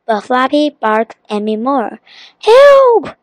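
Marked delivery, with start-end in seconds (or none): 2.4-3.2 very high, loud cry for help. A narrator's reading. The genie is barked at by Flappy, crying out. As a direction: cry out in alarm. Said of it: "cried the genie".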